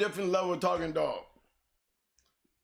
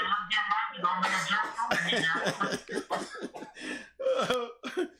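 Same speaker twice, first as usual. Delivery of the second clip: a long helpless laugh; rolling laughter that won't stop. Real, not acted. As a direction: laugh until it hurts. Long laugh, face hurting.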